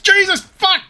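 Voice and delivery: shrill panicked shout; panicked yelling. Real, not acted. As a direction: yell in sudden panic. Bear attack panic yelling.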